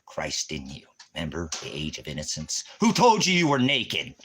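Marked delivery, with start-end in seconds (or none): 2.8-4.1 loud, much higher thundering question; booming divine voice. Acted. God demanding of Adam, loud booming voice. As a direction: boom it out like God calling down. God's question from Genesis quoted.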